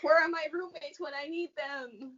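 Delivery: high strained whine; opening stuck jar. Real, not acted. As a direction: whimper while straining. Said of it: "hands can't get this jar open".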